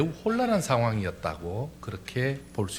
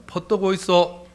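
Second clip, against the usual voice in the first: higher, loud Gyeongsang accent; Gyeongsang dialect; 6 semitones higher, 5 dB louder, slower than usual. Shown as a demonstration. Welcoming someone as in Gyeongsang, Gyeongsang accent. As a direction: call it out like a Gyeongsang local. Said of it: "경상도는요".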